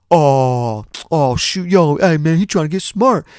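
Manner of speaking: loud, high imitation of a friend egging it on; rowdy put-on "ooh" heckling; hyped-up instigator. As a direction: stir up the fight with mock outrage. Grady egging on, acted out.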